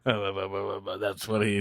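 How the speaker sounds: slow, low put-on Tommy Lee Jones drawl; Tommy Lee Jones impression. Vlogger imitating Tommy Lee Jones in the movie, slow and low. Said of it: "that's my impression of Tommy Lee Jones".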